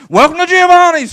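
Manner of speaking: high loud drive-thru yell; screaming welcome. Pretend. Drive-thru worker screaming out the window, loud. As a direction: shout it out the window. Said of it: "screaming out the window".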